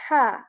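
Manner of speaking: ha said as a clear throat H with short a; fatha ha. Shown as a demonstration. Teacher showing the learner the clear ha. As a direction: say ha from the throat near the chest, then a. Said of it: "This was".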